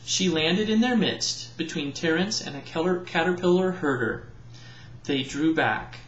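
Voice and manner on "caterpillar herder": ordinary delivery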